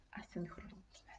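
hushed whisper; whispered term. Real, not acted. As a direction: drop to a whisper for the aside.

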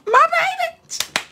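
tender pride. Real, not acted. Proud of her former student.

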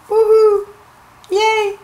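high, gleeful little cheer. Acted. Storyteller to children as the kids stay safe, high cheering.

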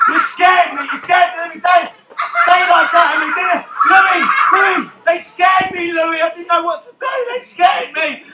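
high, agitated protest; startled complaint. Acted. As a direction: wail to a friend after a fright, hammed-up. Captain complains after being startled.

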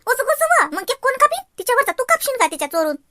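high, incredulous questioning; baffled protest. Acted. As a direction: demand an explanation in a high voice. Aunt baffled by surprise plan. (गोंधळून चढ्या आवाजात प्रश्न)

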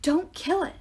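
high, urgent yell. A narrator's reading. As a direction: shout to stop them in time. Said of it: "Grover yelled".

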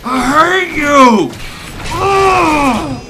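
put-on shrieking furious kid yelling at mom; childhood tantrum. Boy left waiting at pickup yells furiously at his mom. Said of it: "I'd be like".

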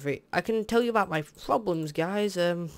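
ordinary talk, nothing marked

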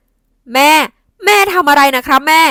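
loud alarmed shout at his mother. A narrator's reading. Son shouting at his mother to stop, loud alarmed shout. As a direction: yell to stop her. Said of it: "ทวัตตะโกนลั่น".

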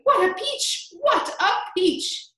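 high, loud delight, slowing on the repeat; jubilant shout. A narrator's reading. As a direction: crow it out, then stretch it. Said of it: "Aunt Spiker shouted".

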